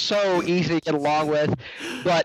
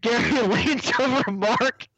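higher voice, breaking into laughter mid-story; cracking up; 7 semitones higher than usual. Real, not acted. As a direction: struggle to get the story out through laughter. Cracking up telling the Gary story.

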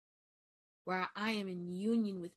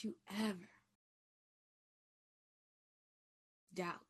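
tender affirmation. Real, not acted. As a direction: speak it like a gentle blessing. Soft self-affirmation, guided calm.

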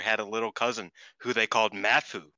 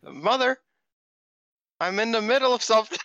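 high, fast annoyed shout; yelling back; 13 semitones higher, 5 dB louder than usual. Real, not acted. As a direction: call back down the hallway.

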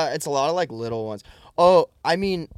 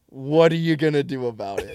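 mock tough-guy challenge, louder; retold cocky dare; challenging; 2 semitones higher, 6 dB louder than usual. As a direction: square up to him, playfully. Retold squaring up with raised eyebrows.

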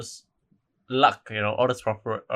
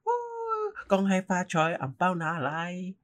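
high, whooping New Year greeting; festive cheer; 10 semitones higher than usual. Acted. Visitor greeting friends at their house, festive cheer. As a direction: call it out high, drawing out the last words.

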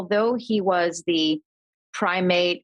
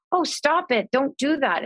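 put-on higher pitched, childish alarmed plea; alarmed protest; 7 semitones higher, slower than usual. Frightened child begs grandfather to stop mimicking, alarmed. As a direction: beg him to stop, upset.

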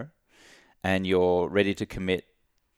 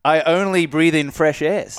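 mock boast; 8 semitones higher, 8 dB louder, slower than usual.

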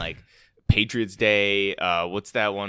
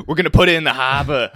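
quick, loud, higher mock Boston accent; 5 semitones higher, 10 dB louder, faster than usual. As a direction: toss it out fast in a hammed-up Boston accent. Wahlberg pilot joke, Boston impression.

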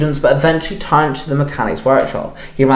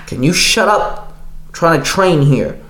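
snapped; 3 semitones higher than usual. Acted. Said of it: "J J snapped".

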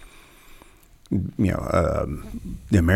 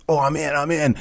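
eager volunteer; 8 semitones higher than usual. Retold or imitated. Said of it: "I was like".

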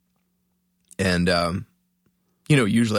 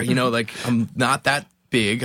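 usual speaking voice

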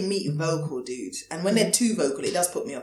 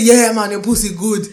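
slow, put-on man's voice; imitated man; 2 semitones higher, 6 dB louder, slower than usual. Friend voicing what a man might say, mock male voice. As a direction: imitate a man giving drawn-out praise. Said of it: "Do you want him to say".

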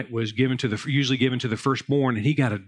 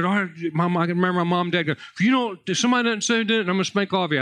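a raised mock-stern mom voice, higher; mimicking mom; 4 semitones higher, faster than usual. Pastor retelling his mom's threat, mock stern. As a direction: lightly play the angry mom threatening the boys.

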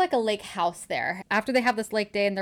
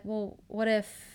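soft, slow, hesitant; tentative; 7 dB quieter, slower than usual. Retold or imitated. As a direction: trail it out quietly and slowly. He hesitantly hints at real feelings.

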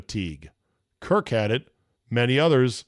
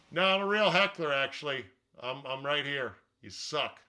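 heckler voice; 2 semitones higher, 7 dB quieter than usual. Pretend. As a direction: heckle from the back row, trailing off. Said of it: "your heckler voice".

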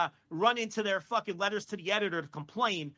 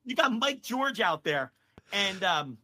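usual speaking voice

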